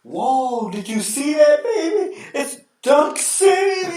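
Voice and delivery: very high, slow, laughing hype; Dick Vitale impression. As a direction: scream it like a thrilled broadcaster. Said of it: "he's like".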